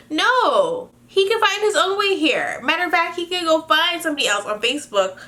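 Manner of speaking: high-pitched, indignant veto; emphatic refusal. Real, not acted. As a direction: push the pitch up and refuse outright. Firmly forbidding her to sponsor him.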